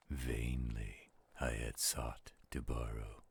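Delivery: slow, hushed, rhythmic recitation with a few whispered lines; dramatic poem reading. Acted. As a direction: draw the listener into a gloomy midnight tale. Continues reciting The Raven.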